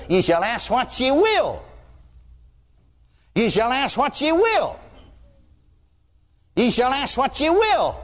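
slow, rising preacher emphasis on will; emphatic scripture repeat. Acted. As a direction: ye shall ask what ye will, pressed slow and high.

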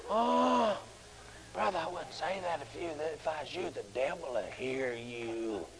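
very soft, low, fearful hush; whispered warning. Retold or imitated. Timid man warning a brother the devil listens, whispering. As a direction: whisper it so nobody overhears. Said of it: "Just whispered".

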